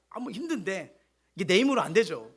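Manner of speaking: ordinary talk, nothing marked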